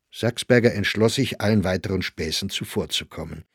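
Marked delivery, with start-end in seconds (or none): none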